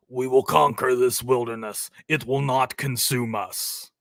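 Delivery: a hint of a grave father figure; mild attempt at the movie voice; second try at the quote. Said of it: "I can't do the accent".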